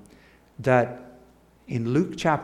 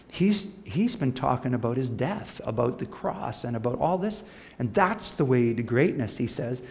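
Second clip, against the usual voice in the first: normal talking voice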